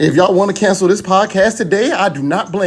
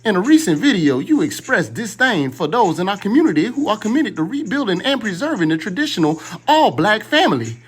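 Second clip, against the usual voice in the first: higher-pitched, preachy imitation of a pan-African activist's accent; Doctor Umar accent; 6 semitones higher than usual. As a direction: read the letter in his solemn, pitched-up accent with mock gravity. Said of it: "why you had to do the accent?".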